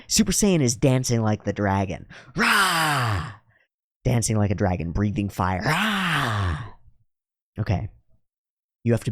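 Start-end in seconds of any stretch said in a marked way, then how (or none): 2.4-3.3 higher, hammed-up dragon roar. Speaker acts out the Super Saiyan dragon, hammed-up roar. 5.6-6.6 higher, hammed-up dragon roar. Speaker acts out the Super Saiyan dragon, hammed-up roar. Dancing like the dragon breathing fire.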